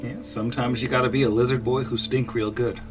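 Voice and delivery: normal talking voice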